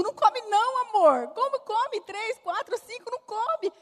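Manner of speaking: high, insistent objection, slowing on 'como come três'; indignant protest. Retold or imitated. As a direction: push back hard at the husband's idea. She protests to her husband. (protesto indignado, agudo e insistente)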